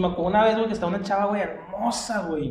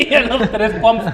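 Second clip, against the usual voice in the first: normal talking voice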